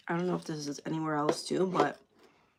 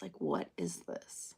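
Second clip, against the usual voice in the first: low, slow, unvoiced aside; whispered aside; 12 semitones lower, 10 dB quieter, slower than usual. Real, not acted. Muttering at a confusing email, low and slow.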